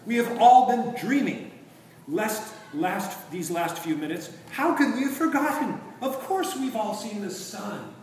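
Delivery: breathless gladness at remembering; joyful prince. A narrator's reading. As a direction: prince shaking off the enchantment. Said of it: "cried the prince".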